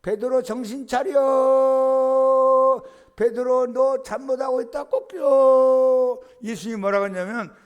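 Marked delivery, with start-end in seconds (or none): 0.0-6.2 very slow, high, loud drawn-out crowing call; rooster's cry. Acted. The rooster crowing at Peter, long high loud cry. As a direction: crow it like a rooster shouting a warning.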